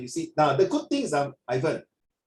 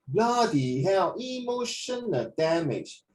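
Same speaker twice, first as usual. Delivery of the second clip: slow, drawn-out meme cry of despair; mock dramatic; 4 semitones higher, slower than usual. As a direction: ham up the despair. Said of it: "mock electrician meme".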